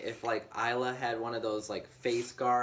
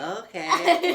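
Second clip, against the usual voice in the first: put-on much higher, louder laughing kid voice; squeaky kid voice; 7 dB louder than usual. Dad voicing kid, laughing.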